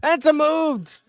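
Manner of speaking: shouting the big spot, high and slow; excited shout. Real, not acted. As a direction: yell it like a live call. Live reaction to a spear.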